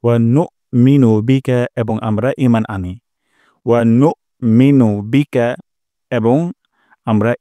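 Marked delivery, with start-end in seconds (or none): 0.0-1.7 নুমিনু with a firm, hard glottal stop; slow recitation. Shown as a demonstration. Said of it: "একটা শক্ত strong sound করব". 3.7-5.6 নুমিনু with a firm, hard glottal stop; slow recitation. Shown as a demonstration. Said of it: "একটা শক্ত strong sound করব".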